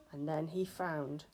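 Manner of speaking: ordinary delivery, nothing marked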